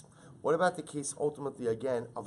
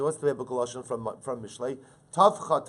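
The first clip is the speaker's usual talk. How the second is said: ordinary talk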